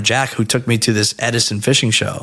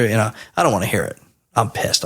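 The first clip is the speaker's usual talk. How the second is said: normal talking voice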